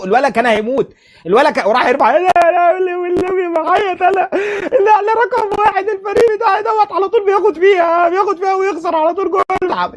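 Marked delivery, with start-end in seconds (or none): 2.1-9.9 high, whining put-on voice of a sulking player; mock whiny crybaby. Mocking the fouled player's whining, high and pleading. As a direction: whine it high like a spoiled kid about to cry. Mocks player crying after tackle.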